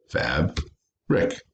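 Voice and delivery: "fab, rick" in two separate beats; split into syllables. Shown as a demonstration. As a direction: break "fab, rick" apart with a pause. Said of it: "when it's two syllables".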